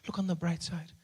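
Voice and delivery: soft, hushed calming; mother calming her son, soft and quiet; calm mom. Retold or imitated. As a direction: quietly talk him down. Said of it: "And she says".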